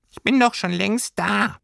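high, tiny creature voice; a small homesickness sprite speaking up; little Heimweh voice. A narrator's reading. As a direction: homesickness creature answering Lilly from above.

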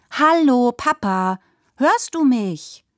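high, loud call for attention; a child trying to get Papa to notice. Acted. Child calling to distracted Papa.

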